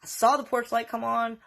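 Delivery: ordinary delivery, nothing marked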